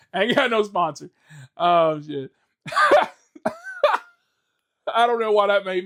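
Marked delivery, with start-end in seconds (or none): 2.6-3.1 high, loud laugh bursting out; sudden high laugh; burst of laughter. Real, not acted. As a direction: crack up at yourself. Laughs after "oh shit.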